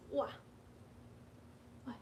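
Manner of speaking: ordinary talk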